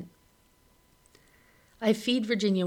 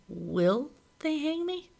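panic; 9 semitones higher, slower than usual. A narrator's reading. Narrator panics inside.

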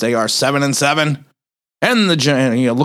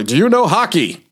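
higher, lightly put-on boss voice; mock boss voice, pitched up; mimicked radio executives; 6 semitones higher than usual. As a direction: imitate the station people asking a question. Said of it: "why they talk like this".

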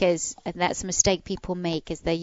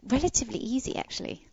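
whispered aside; 5 semitones higher, 4 dB quieter, faster than usual. Real, not acted. Trailing aside.

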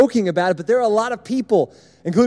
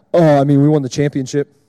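lower, deep bored monotone; monotone Kason; 5 semitones lower, 7 dB louder than usual. Retold or imitated. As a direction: say the good news like it means nothing. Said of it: "in a monotone".

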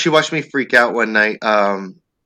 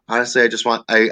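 ordinary talk, nothing marked

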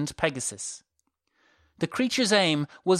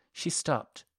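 ordinary delivery

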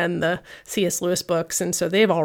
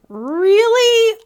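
much higher, louder "really"; deflated disbelief, pitched way up; disbelieving; 14 semitones higher, 6 dB louder than usual. Retold or imitated. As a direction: stood up at an empty meetup. Nobody showed up to meetup.

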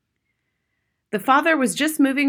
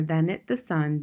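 ordinary delivery

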